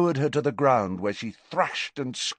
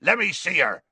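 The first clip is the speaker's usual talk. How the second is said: raised, urgent command; shouting; 7 semitones higher than usual. A narrator's reading. Hagrid reaching injured Katy, raised commanding voice. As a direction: call out to be let through. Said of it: "shouted Hagrid".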